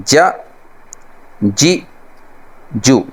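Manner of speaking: just a quick sound for each; short clipped syllables. Shown as a demonstration. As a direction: say جا جی جو short, not stretched. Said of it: "اس کو لمبا نہیں کرنا". (مختصر آواز میں جا جی جو)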